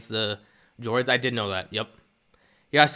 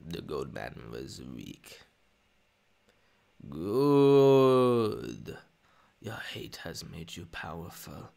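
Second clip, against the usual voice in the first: Emperor voice; 6 semitones lower, much slower than usual. Pretend. Streamer doing the Emperor, low and slow. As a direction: croon corruption like an old emperor. Palpatine lines after Poggle request.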